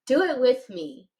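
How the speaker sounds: ordinary talk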